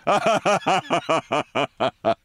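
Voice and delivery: staccato mock laugh, slowing down. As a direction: laugh mockingly in separate, evenly pitched bursts. Laughs at own team-player remark.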